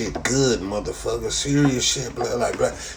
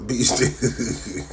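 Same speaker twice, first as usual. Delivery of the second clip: amused; much slower than usual. Real, not acted.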